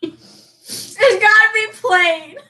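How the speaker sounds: slow words laughed out; laughing. Real, not acted. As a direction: say it while laughing. Laughing guess.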